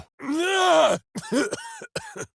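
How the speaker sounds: high yelled cry; strained shout. Acted. Warrior crying out in combat, a high yell. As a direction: let out a sharp high yell.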